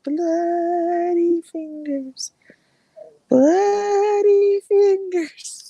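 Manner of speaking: very slow, high, drawn-out ghostly moan; eerie ghost voice. Acted. Storyteller at a campfire doing the ghost's rising moan. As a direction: stretch every syllable like a haunting. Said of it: "he's like".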